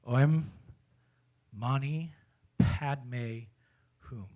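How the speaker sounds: OEM, MANI, PADME, HUM one word at a time, with pauses; pronunciation demo. Shown as a demonstration. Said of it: "the words of this are pronounced".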